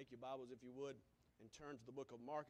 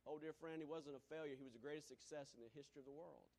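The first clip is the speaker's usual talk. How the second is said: ordinary talk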